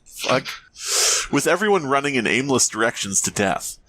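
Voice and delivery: ordinary talk, nothing marked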